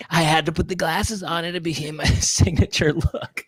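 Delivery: slow, laid-back surfer drawl; surfer-style Whoopi impression. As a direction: stretch it out like a surfer. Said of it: "She sounds like a surfer".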